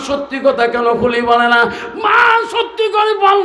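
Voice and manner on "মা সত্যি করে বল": high, loud shout begging for the truth; shouted plea. Acted. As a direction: demand the truth at full voice. Son begs mother for truth.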